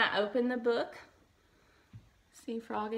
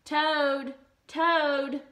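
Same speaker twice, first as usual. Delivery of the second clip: loud calls, higher pitch; crying out; 5 semitones higher, 6 dB louder than usual. A narrator's reading. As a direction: call through the door. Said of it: "cried Frog".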